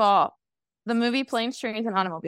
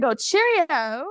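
put-on British accent, pitched up; mock British accent; 4 semitones higher, slower than usual. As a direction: chirp a hammed-up British greeting. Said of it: "Your British accent".

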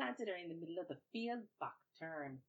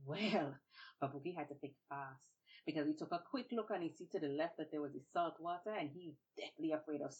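plain reading voice

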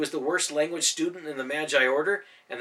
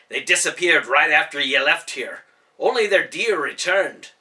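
angry warrior accusing; 2 semitones higher, 10 dB louder than usual. A narrator's reading. Ben accusing Nicholas in fight.

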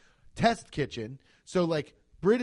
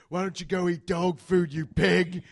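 loud mock chef's rage; acted angry telling-off; yelling at customers; 3 semitones higher, 6 dB louder than usual. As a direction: throw the insult at a diner. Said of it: "He yells at customers".

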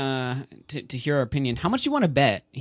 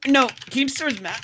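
choking, coughing through words; choking on a drink; 11 semitones higher, slower than usual. Real, not acted. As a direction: talk while choking on a drink. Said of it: "I'm, like, choking right now".

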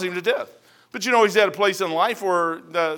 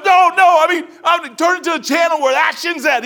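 higher, louder, laughing call for the action; laughing shout; 9 semitones higher, 7 dB louder than usual. Retold or imitated. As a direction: laugh and shout to get the radio changed.

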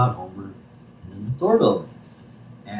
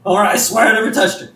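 much higher, louder, panicky Moe; panicked Moe; 7 semitones higher, 4 dB louder than usual. Retold or imitated. Moe caught by Homer, panicked and loud. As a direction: blurt out a guilty denial in a high voice. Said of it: "He's like".

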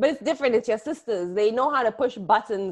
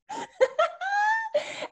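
squealing laugh much higher; high giggle. Real, not acted. Laughing at the grandaunt's sneak attacks, high giggle.

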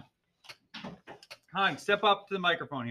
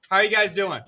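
ordinary delivery

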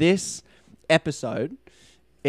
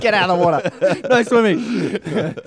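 laughing; 6 semitones higher, 6 dB louder than usual. Acted. Teasing joke with laughter.

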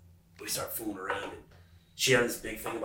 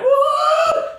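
shrill high yelp, loud and sudden; imitated scream; 13 dB louder than usual. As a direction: yell it like she's startled and screaming. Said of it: "She started screaming, like".

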